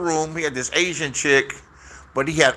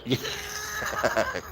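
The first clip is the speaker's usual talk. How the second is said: high squealing laugh; sudden high-pitched cackle; burst of laughter; 9 dB quieter than usual. Real, not acted. Laughing at his story.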